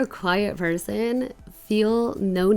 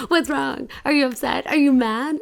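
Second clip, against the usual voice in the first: mock worried questions; 7 semitones higher, 6 dB louder than usual. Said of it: "they're like".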